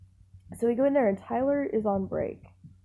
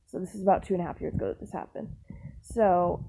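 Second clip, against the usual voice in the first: usual speaking voice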